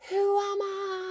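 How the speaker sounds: high, long-held imitation scream; mock yell from a mountaintop, stretched right out; Jackie Chan. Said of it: "and screams".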